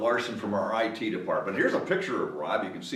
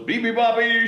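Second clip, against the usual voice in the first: high, loud seal shriek; hammed-up seal shriek; 11 semitones higher, 5 dB louder than usual. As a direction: squeal it like a seal. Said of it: "shrieked the seals".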